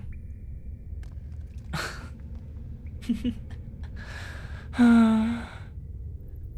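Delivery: long breathy laugh, quiet and airy; soft laugh. Acted. She laughs at his compliment.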